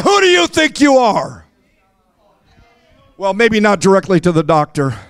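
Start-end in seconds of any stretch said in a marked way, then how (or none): 0.0-1.5 higher shouted challenge. Shown as a demonstration. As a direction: throw it at the bad news. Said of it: "look at that doctor and say".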